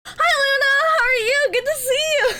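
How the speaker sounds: high, giggly hello to a viewer; excited greeting. Real, not acted. Streamer greets a chatter by name, high and giggling. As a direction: beam a bright welcome with laughter.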